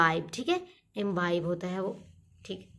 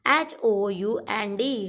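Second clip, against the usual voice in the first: slow spelling out; much slower than usual. Shown as a demonstration. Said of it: "तो next रहेगा hound".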